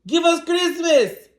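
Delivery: slow, high, loud troll shout; shouted demand. A narrator's reading. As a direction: demand it at the top of your voice. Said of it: "they shouted".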